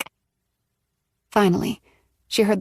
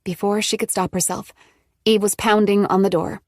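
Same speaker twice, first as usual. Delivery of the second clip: ordinary delivery, nothing marked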